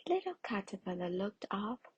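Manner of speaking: ordinary delivery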